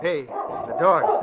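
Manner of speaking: a sudden drawn-out cry of alarm at the dogs; slow, high and startled. Acted. Reporter spotting charging dogs, alarmed cry.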